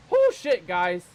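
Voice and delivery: loud relieved whoop; excited whoop. Real, not acted. Relief after killing jaguar.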